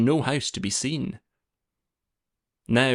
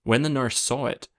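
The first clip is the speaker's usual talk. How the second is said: usual speaking voice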